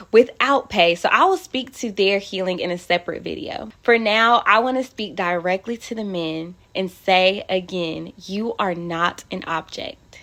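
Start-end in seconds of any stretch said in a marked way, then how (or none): none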